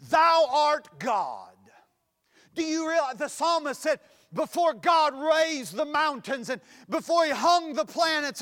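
loud, high-pitched scripture proclamation; full-force preaching. Real, not acted. Preacher declaims the psalm to the congregation, preaching at full force. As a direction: ring the psalm out over the congregation.

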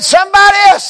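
shouted call to the congregation; booming altar call; loud call. Retold or imitated. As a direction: call it out at full voice. Preacher calling out in service.

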